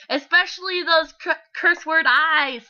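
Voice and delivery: loud, high, drawn-out horror read; dramatic reading. Shown as a demonstration. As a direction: build it up big and slow for effect. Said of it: "it's dramatic".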